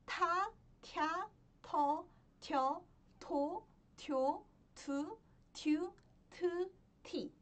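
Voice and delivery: "Ta, kya, to" recited one by one, each swinging upward; syllable drill. Shown as a demonstration. Teacher modelling the next consonant for learners, syllable drill. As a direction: chant out the table evenly, syllable by syllable.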